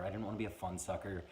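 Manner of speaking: ordinary delivery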